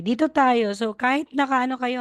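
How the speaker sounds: usual speaking voice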